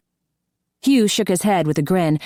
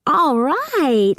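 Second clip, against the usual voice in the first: put-on high, bright little girl cheer; excited child; 10 semitones higher than usual. A narrator's reading. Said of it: "clapped her hands with excitement".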